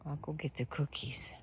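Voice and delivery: soft whisper about treats. Real, not acted. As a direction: whisper the treat plan to the donkeys.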